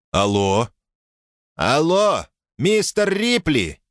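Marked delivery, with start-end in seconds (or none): 1.6-3.8 put-on high, slow, taunting American accent; mocking American accent. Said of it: "насмешливо произнёс самоуверенный голос с американским акцентом".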